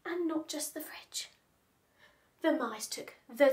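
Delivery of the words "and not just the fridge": hushed unvoiced; whisper. A narrator's reading. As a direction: whisper it darkly.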